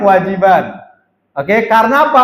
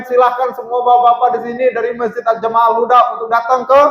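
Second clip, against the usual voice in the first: mock group announcement; faster than usual. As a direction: blare it out like a group-wide announcement. Meniru pengumuman di grup. (pengumuman keras dan cepat ala grup, setengah bercanda)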